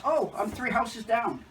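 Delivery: customer drawn out and high; slow, high caller voice. Retold or imitated. Caller reveals she lives nearby, slow and high. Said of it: "She goes".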